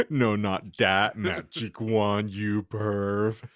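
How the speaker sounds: ordinary talk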